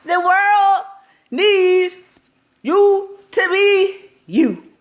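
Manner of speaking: loud, high and slow, each word stretched; emphatic proclamation. Real, not acted. Telling viewers to be themselves, loud slow proclamation. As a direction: declare it to the whole world, drawn out.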